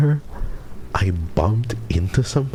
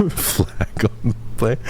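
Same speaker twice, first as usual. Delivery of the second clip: slow, breath-starved laughing words; real laughter; 4 dB quieter than usual. Real, not acted. Streamer reading chat's verdict on the fumble, helpless laughter. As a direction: read it out while cracking up.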